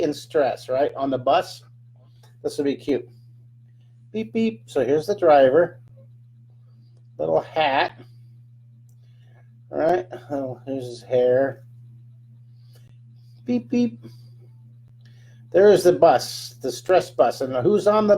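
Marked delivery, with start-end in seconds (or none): 4.1-4.6 high playful beep beep like a toy horn; bus horn beep. Pretend. Drawing a bus for viewers, playful high horn noise. 13.4-14.0 high playful beep beep like a toy horn; bus horn beep. Pretend. Drawing a bus for viewers, playful high horn noise.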